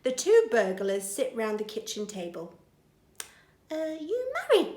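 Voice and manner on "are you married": high, coy question; coy Betty. Acted. Betty asks Bill shyly, coy. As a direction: fish for an answer. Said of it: "says Burglar Betty".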